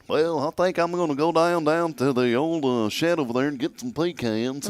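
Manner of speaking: high, hammed-up rural old man; hammed-up country old man fetching pecans; old-timer voice. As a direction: play a country old-timer heading to the shed.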